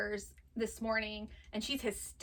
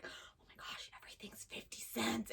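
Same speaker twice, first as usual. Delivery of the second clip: unvoiced, much softer thrilled whisper; excited whisper; 14 dB quieter than usual. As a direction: whisper it like a secret bargain. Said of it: "I'm like".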